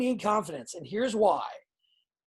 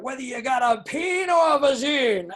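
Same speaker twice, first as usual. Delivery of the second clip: loud, higher hyped-up wrestling line; quoted shouted line; 5 semitones higher, 5 dB louder than usual. Retold or imitated. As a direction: belt it out like a crowd-pleasing catchphrase. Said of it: "I popped so hard for that".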